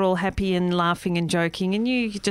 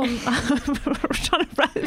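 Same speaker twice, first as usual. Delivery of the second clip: laughing; 4 semitones higher than usual. Real, not acted. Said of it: "speak quickly".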